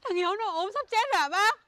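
hammed-up wailing call. To the uncle about dying Hiếu, frantic high wailing. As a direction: wail the names like disaster struck, half laughing.

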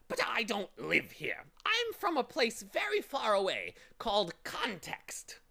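high, loud bird voice; bird from Context. Acted. Bird telling Milo where he lives, high and loud. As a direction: tell of home as a squawky bird.

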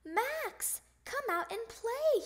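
high, singsong call to a friend; hollering. A narrator's reading. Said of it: "he hollered".

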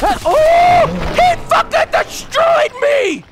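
high anguished yell; pained shout. Real, not acted. Gamer shouting after being destroyed. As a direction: cry out in real frustration. Killed by enemy in game.

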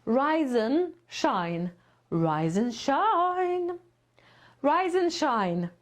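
"Rise and shine" slowly with and reduced to n; linked rise and shine. Shown as a demonstration. Teacher showing students how and shrinks to n. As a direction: glide "Rise and shine" as one word, rize-n-shine. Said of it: "Czyli to wymówimy jako N".

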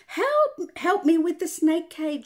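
high, loud cry for help; shout for help. A narrator's reading. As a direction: yell for help with the snake. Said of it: "shouted Charlie".

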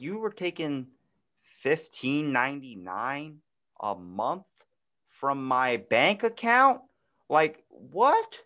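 slow, drawn-out disbelief, climbing to the last word; mock incredulity. Player recalling RuneScape still charging for an unplayed game, incredulous. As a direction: stretch it out as an incredulous question.

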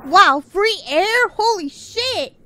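ordinary talk

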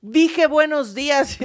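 saludo agudo, lento y recalcado. Retold or imitated. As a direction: repite el saludo como reproche, en imitación. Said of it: "Mi hermano dice". (reproche exagerado del hermano)

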